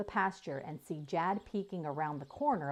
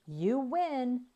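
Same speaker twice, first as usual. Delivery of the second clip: shout; 7 semitones higher than usual. A narrator's reading. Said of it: "shouted Jaya".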